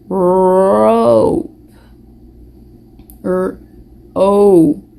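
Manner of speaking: "Rope" said with a long drawn O; stretching the vowel. Shown as a demonstration. Teacher stretching the O sound for the kids. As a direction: stretch the O in "Rope", then just "ope". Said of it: "What does the O in rope sound like".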